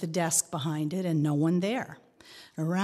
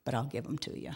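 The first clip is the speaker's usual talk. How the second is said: hushed clerk; 5 semitones lower, 8 dB quieter, faster than usual. Retold or imitated. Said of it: "she says".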